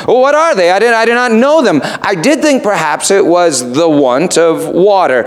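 high, stammering protest; flustered Mister Vane. Acted. Preacher voicing Mister Vane stammering back at the raven.

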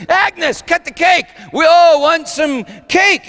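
high, loud call across a party; impatient urging. Acted. Harry urges Agnes at her party, impatient shout. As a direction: call out, drawing out the last demand.

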